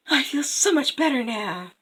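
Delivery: lightly voiced revived queen; relieved queen. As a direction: sigh it out, hammed-up recovery. Queen revived after eating heart.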